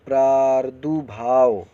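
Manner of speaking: प्रादुर्भाव read slowly and loudly as the wrong form; loud, slow misreading of प्रादुर्भाव; wrong pronunciation. Shown as a demonstration. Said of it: "ये गलत है".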